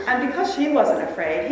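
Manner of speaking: usual speaking voice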